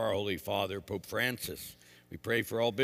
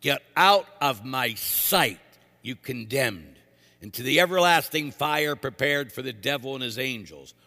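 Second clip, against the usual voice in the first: booming sentence of doom; condemning judge; 8 dB louder than usual. Retold or imitated. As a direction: throw them out with thunder. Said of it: "we're all gonna hear".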